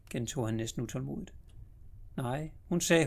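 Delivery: ordinary delivery, nothing marked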